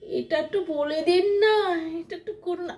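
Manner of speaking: coy fawning. Retold or imitated. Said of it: "তাদের সামনে ন্যাকামি করে".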